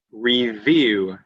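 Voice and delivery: "review" sounded out as re-view, firm v; emphasised middle V. Shown as a demonstration. Teacher giving a middle V example, deliberate v. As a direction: articulate the v inside "review". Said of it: "Using V in the middle of a word".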